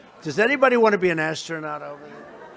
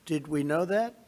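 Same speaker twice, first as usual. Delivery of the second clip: ordinary talk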